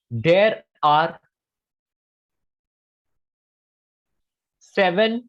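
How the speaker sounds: slow dictation. Shown as a demonstration. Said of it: "तो directly लिख लो".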